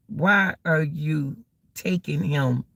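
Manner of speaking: ordinary talk, nothing marked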